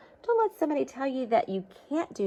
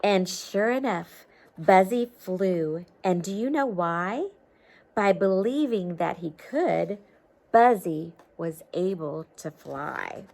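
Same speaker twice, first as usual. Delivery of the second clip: lively storybook read-aloud; reading to children; 7 dB louder, slower than usual. A narrator's reading. Teacher reading a storybook to children, lively read-aloud. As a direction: read it like a picture book to kids.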